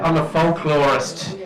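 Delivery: normal talking voice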